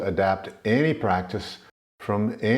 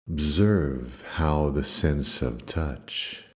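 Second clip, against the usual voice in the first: low, calm meditation voice with long pauses; low-pitched, soothing yoga nidra guidance; guided meditation; 7 semitones lower, 4 dB quieter than usual. Acted. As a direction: ease them gently into stillness, lower and unhurried.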